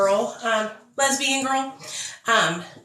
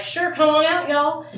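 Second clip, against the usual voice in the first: higher, louder friendly call; cheery invite; 7 semitones higher, 3 dB louder than usual. Retold or imitated. Friends inviting them to Malvern, upbeat and higher. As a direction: sing out the welcome.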